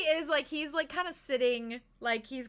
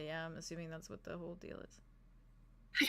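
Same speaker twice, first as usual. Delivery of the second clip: whisper; 5 semitones lower, 12 dB quieter, slower than usual. Real, not acted. Whispered aside.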